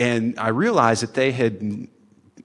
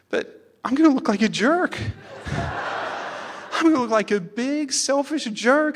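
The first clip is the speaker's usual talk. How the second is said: put-on crying child. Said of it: "And he just started crying".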